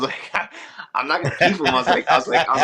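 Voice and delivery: laughing through the refusal. Retold or imitated. Laughing refusal retold to Caesar.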